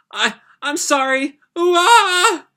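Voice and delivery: high, loud sobbing apology; a scared child breaking into tears; very high wail at the end; crying apology. Acted. Girl apologizes, bursts into tears.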